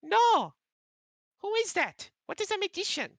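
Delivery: very high loud baffled squeak; a little owl who never heard the word; puzzled owl. Acted.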